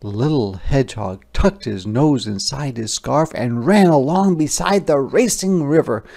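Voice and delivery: plain reading voice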